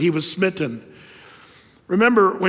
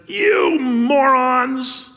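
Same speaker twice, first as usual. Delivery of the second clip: slow, loud, higher angry shout; 7 semitones higher, 4 dB louder, much slower than usual. Retold or imitated. Moses yelling at the thirsty people, slow angry shout. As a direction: drag out the insult in a fury. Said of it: "And Moses is ticked off".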